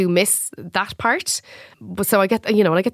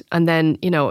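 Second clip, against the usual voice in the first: normal talking voice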